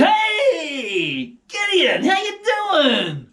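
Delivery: high, bright-voiced breezy greeting, drawn out slowly; a chirpy angel saying hi; cheery angel. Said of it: "they came and said".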